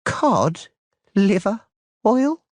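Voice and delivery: Cod, liver, oil, spaced with long pauses; slow, deliberate enunciation; each word slowly. A narrator's reading. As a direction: spell out each word in disbelief. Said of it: "enunciating each word very slowly".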